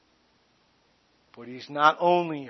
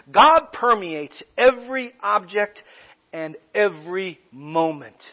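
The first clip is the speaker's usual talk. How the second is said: ordinary delivery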